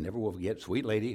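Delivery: usual speaking voice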